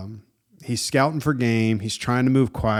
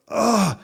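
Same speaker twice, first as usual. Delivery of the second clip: high, strained ugh of disgust; disgusted gagging ugh; 9 semitones higher than usual. Retold or imitated. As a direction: gag on the word like choking on fumes. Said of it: "People in the store are like".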